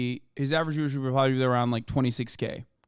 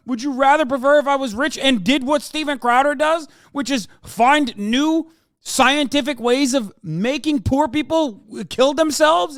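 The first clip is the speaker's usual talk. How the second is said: much higher and louder, yelling in real anger; furious shout; 11 semitones higher, 7 dB louder than usual. Real, not acted. Streamer yelling at critics who call him rich: furious shout.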